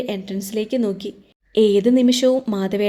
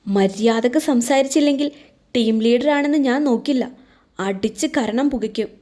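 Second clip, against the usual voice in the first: plain reading voice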